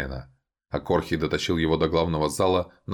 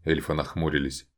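plain reading voice

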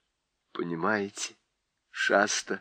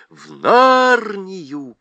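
louder, slow rallying cry; all cry out for Narnia; shouted together; 7 semitones higher, 8 dB louder, much slower than usual. A narrator's reading. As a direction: cheer it like a crowd. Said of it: "крикнули все".

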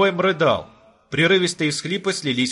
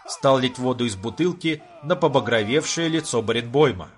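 ordinary delivery, nothing marked

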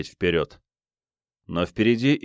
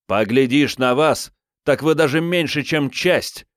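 furious outburst; 6 semitones higher, 4 dB louder than usual. Acted. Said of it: "яростно выпалил Юстас".

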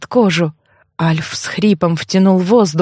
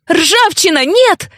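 high-pitched cry to stop; narrated yell, pitch leaping up; alarmed yell; 9 semitones higher, slower than usual. A narrator's reading. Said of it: "заорала я".

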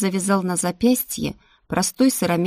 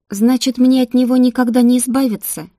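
higher, louder, urgent question; raised, agitated heroine's voice; dismayed protest; 5 semitones higher, 7 dB louder than usual. A narrator's reading. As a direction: demand an answer in dismay.

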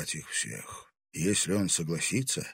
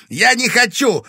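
very loud, high furious yell; furious shout; 15 dB louder than usual. Acted. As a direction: scream it in fury. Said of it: "яростно крикнул".

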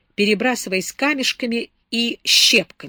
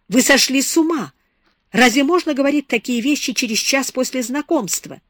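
outraged; 6 semitones higher, 5 dB louder than usual. A narrator's reading. Beatrice outraged by Jan.

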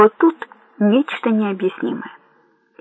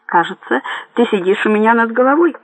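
ordinary delivery

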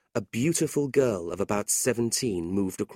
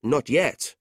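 put-on proud young man, voice raised; 6 semitones higher, 4 dB louder than usual. A narrator's reading. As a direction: answer your uncle with pride. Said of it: "Nicholas answered proudly".

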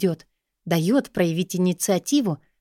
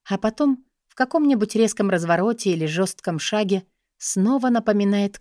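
plain reading voice

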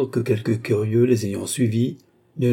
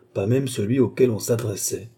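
usual speaking voice

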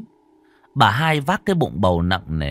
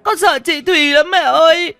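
put-on very high child's wail, building louder; loud frightened wailing; 5 dB louder than usual. A narrator's reading. Little boy cries to his mother about the ghost, wailing. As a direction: cry out to mother in terror. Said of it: "khóc váng cả lên".

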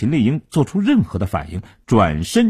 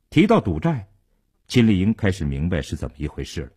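usual speaking voice

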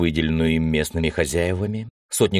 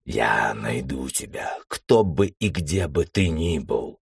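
slow unvoiced hissed threat; 3 semitones lower, slower than usual. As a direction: whisper it with cold hatred. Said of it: "Император прошипел".

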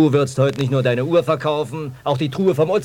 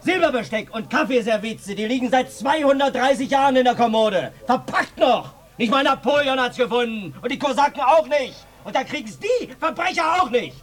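high, furious bellowing tirade; roaring rant; 10 semitones higher than usual. Acted. Hagi rages at the villagers about his heirlooms, roaring. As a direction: roar it like a lion defending his house. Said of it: "Der brüllt ja wie ein Löwe".

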